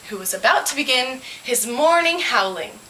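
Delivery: plain reading voice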